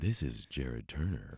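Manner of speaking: slow smooth late night DJ voice; low, slow and smooth like a radio host. Pretend. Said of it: "a late night D J voice".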